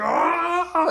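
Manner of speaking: high yelp of a man flung away; a sharp, very high cry; hammed-up scream as he goes flying; mock scream. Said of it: "He was like".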